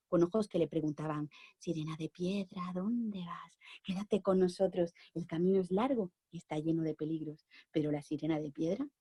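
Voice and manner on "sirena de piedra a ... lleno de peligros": whispering statues. Acted. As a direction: hush it like stone statues murmuring. Statues asking the mermaid.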